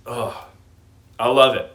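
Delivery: usual speaking voice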